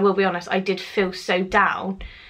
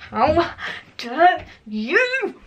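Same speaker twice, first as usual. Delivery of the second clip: high, word-by-word mock fury; built-up anger, acted; 8 semitones higher, much slower than usual. Scraping her fork over dinner, furious at her friend, hammed-up. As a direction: split each word with seething, exaggerated indignation. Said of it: "I was getting so angry and built up inside".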